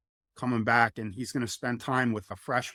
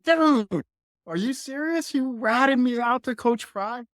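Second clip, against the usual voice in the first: very high, loud outrage at a friend; outraged disbelief; 12 semitones higher, 4 dB louder than usual. Retold or imitated. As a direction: yelp it, stunned and betrayed. Retold outrage at being ratted out.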